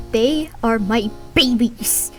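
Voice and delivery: usual speaking voice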